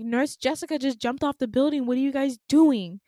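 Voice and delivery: loud, urgent protest; retold screaming. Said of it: "I'm screaming and I'm like".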